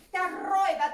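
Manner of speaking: laut hervorgestoßen; lauter Ausruf. Pretend. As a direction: ruf es triumphierend heraus. Entlarvung des Räubers. (lauter, triumphierender Ausruf)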